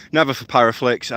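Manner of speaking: ordinary delivery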